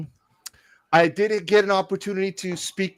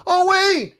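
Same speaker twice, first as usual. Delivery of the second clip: excited; 6 dB louder than usual. Real, not acted. Excited reveal.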